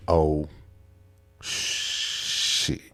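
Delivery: barely voiced, hushed and tense; scared whisper. Caught smoking as the cop stops, hushed whisper. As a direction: whisper it so the cop can't hear.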